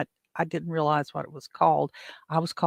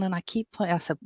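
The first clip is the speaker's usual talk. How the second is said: usual speaking voice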